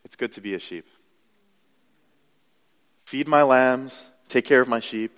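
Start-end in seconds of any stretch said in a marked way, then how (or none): 3.0-5.1 slow, deliberate "Feed my lambs" with "my" stressed; slow emphatic repeat. Real, not acted. Preacher repeating Jesus's charge to the church, slow and emphatic. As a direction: weigh every word of the charge.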